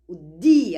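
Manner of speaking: "di" punched out loud; stress on di. Shown as a demonstration. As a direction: say "di" loud and clear, isolating the syllable. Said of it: "prestar atenção que começava di". (ênfase forte em "di")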